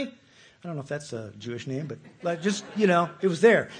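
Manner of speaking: usual speaking voice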